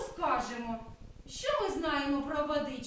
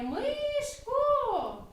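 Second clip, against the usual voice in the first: high, louder playful call; 9 semitones higher, 4 dB louder than usual. Acted. The cloud calls down to the mouse, high and louder. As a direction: shout the mouse's name from above. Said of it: "Хмаринка гукає".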